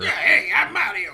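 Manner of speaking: laughing mock cartoon Mario shout; a hint of the TV-show Mario; playful gruff plumber greeting. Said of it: "a deeper, like, huskier".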